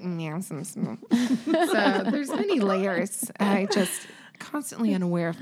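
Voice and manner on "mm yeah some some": low, soft murmured sample; low voice demo. Shown as a demonstration. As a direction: drop the voice down and let it fade. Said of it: "and then I'm like".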